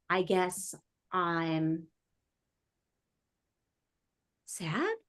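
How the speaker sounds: very quiet, halting, unsure answer; stumped, hesitant. Acted. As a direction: trail it out slowly and softly, ending on a tentative question. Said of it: "they're stumped".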